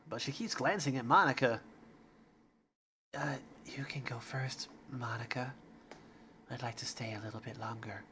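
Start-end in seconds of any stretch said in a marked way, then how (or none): 3.2-5.5 whispering. Acted. Shy Yuri speaks. 6.5-8.0 much softer timid voice. Acted. Shy Yuri.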